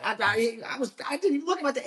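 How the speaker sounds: high, defensive mock protest; mock combative protest. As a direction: play the defensive driver, half-joking. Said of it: "a little combative".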